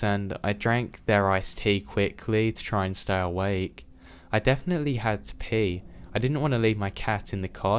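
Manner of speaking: ordinary delivery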